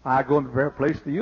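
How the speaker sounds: fast rote recital, meaning not felt; rattled off by rote. Shown as a demonstration. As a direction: rush it like a line learned by heart. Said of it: "We all know that in the mind".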